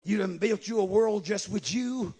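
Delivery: ordinary delivery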